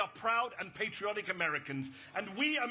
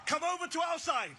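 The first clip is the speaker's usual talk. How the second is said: rallying call; 5 semitones higher than usual. Real, not acted. Rally speaker calls the crowd to her side, rousing. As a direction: lift the invitation, rallying the crowd.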